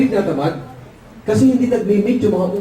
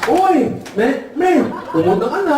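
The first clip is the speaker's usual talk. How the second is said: high ngongo greeting; nasal-speech child; 7 semitones higher, slower than usual. Acted. Ngongo child greeting the ngongo customer, high nasal speech. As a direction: call out in muffled cleft-palate speech. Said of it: "Pagpunta ng anak ng ngongo".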